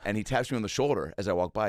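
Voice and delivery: ordinary delivery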